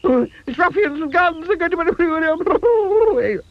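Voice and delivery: very high, breaking off mid-wail; frantic panic. A narrator's reading. Mister Jelly fearing ruffians, frantic high wail. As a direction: wail then stop short. Panics under falling leaf.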